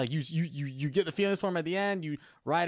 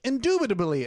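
higher pompous dictation; mock pompous; 5 semitones higher, 4 dB louder than usual. Man dictates a fancy word while walking, mock pompous. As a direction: lightly play the fancy talker.

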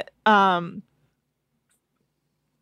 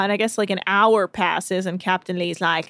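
ordinary talk, nothing marked

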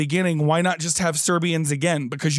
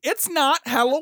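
raised, playful repeat; high-pitched quote; 7 semitones higher than usual. Retold or imitated. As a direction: sing it up again, lighter. Quoting again.

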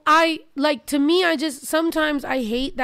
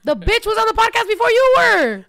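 heated argument; 9 semitones higher, 5 dB louder than usual. Real, not acted. Arguing about the cousin.

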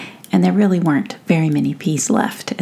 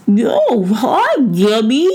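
higher-pitched, muffled mouse greeting; mouth full; 4 semitones higher, 3 dB louder, slower than usual. A narrator's reading. Mouse greets a friend mid-feast, mouth full. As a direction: talk through a mouthful of peas. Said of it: "through a mouthful of green peas".

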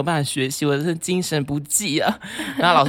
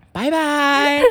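sing-song goodbye; 4 dB louder than usual. Retold or imitated. Taunting goodbye to roommate.

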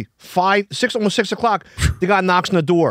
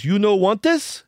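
put-on slow, slightly higher Chinese-accented question; light Chinese-accented English; 4 semitones higher, slower than usual. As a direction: ask with a hint of a Chinese accent. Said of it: "I'm not doing a racist accent".

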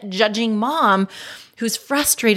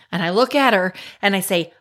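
ordinary delivery

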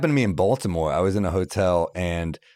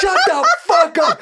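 much higher, louder, slow laughing mock scream; screaming owner; 7 dB louder, slower than usual. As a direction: explode at the rowdy crowd. Owner snaps at crowd.

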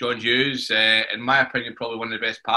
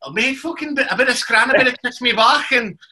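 high-pitched, hammed-up Brookside Scouse; exaggerated Scouse from the back of the throat; mock Scouse accent; 10 semitones higher than usual. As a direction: put on a throaty Liverpool accent, much higher. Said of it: "I'm trying to Brookside accent".

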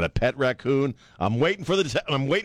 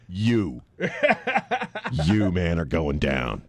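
low, slow mock threat; menacing raccoon; 7 semitones lower, slower than usual. Raccoon eyeing him through glass door, low mock menace. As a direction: deliver a comic threat with long pauses. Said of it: "had a look in its eye like".

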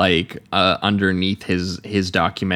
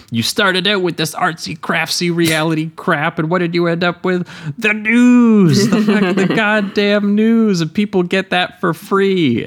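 ranting; 10 semitones higher than usual. Retold or imitated. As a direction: blow up at the producers, dragging out the news. Quoting Brooks' rant at producers.

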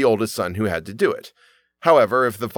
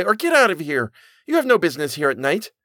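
high raised warning, quickening; shouted; 6 semitones higher than usual. A narrator's reading. Boy orders the ghost away, raised and high. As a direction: drive the stranger off, fast at the end. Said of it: "shouted the boy".

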